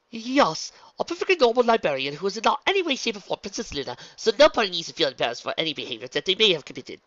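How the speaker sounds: loud, high, rambling cover-up; nervous babbling. A narrator's reading. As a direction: babble on overemphatically, trying to convince. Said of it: "Luna babbled".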